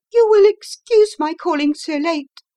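a frightened visitor barely holding together; veiled woman apologising, high and strained; distressed lady. A narrator's reading. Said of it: "she began".

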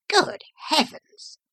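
high exclamation of horror; shocked cry. A narrator's reading. Doctor sees the horrible wound, shocked high cry. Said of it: "I cried".

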